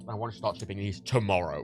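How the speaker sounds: ordinary delivery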